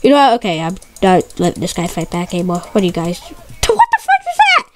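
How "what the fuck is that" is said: very high, slow shocked cry. Real, not acted. Gamer shocked by something on screen, high startled cry. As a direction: react in disbelief.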